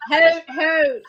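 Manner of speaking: loud double owl hoot. Acted. Player voices owl character.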